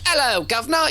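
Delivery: high, hammed-up Cockney British accent. Showing off a bad British accent at a party, hammed-up. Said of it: "doing all the best British accents".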